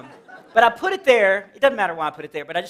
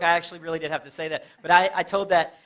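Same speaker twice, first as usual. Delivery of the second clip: normal talking voice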